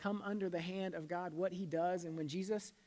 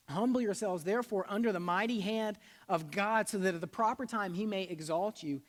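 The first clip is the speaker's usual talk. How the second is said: loud, higher proclaiming of the verse; scripture reading; 4 semitones higher, 6 dB louder than usual. Acted. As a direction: read it out like a preacher proclaiming. Reads scripture aloud forcefully.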